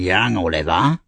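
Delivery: lightly voiced Sikes, not shouted; Bill Sikes's cry. A narrator's reading. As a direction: call the boy in a restrained rough-man role. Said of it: "cried Bill Sikes".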